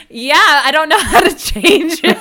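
imitated sheepish admission, loud and laughing; laughing reply. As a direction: deliver the punchline high, through laughter. Laughing through the retold punchline.

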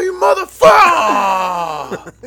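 long, slow, very loud shout, pitched high; furious yell. Retold or imitated. Crashed biker yelling at the girl, shouting hard. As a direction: roar it out in rage. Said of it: "I'm all".

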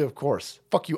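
normal talking voice

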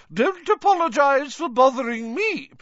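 high, loud, indignant sarcasm; hammed-up huffy fat lady; irritable calling. A narrator's reading. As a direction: call after them in a huff. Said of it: "called irritably after them".

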